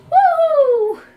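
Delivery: very high, loud whoop; cheer. Real, not acted. Gamer cheering a hit on the dragon, loud whoop. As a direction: whoop with triumph.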